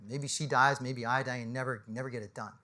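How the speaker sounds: quietly choked by the memory; emotional. Real, not acted. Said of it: "I'm a little emotional about that one".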